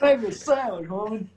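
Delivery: high, laughing hammed-up chauvinist; mock macho demand. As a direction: ham up a lazy husband's order.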